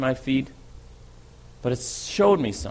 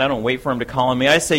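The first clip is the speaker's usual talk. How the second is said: usual speaking voice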